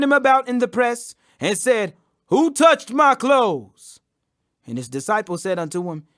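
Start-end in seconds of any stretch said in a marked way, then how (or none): 2.3-4.0 slow searching question. A narrator's reading. Jesus asks in the crowd.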